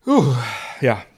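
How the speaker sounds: croaky from swallowing wrong; forcing the word out after choking; strained after choking. Real, not acted.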